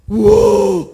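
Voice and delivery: explosive, very loud wow; full-force gasp of wonder on first sight; awestruck exclamation. Acted. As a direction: burst out in acted amazement. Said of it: "이 정도였다는 거예요".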